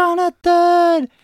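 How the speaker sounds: high, loud drawn-out name call; hammed-up distant mother yelling; imitated mom's scream. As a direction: mock-scream for a runaway child. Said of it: "it's my mom screaming for me".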